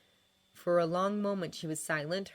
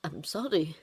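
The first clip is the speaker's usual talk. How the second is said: halting, regretful condolence; sincere, wistful pauses. A narrator's reading. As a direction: say it slowly, lost in memory. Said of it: "Regret crossed his face, now far more sincere".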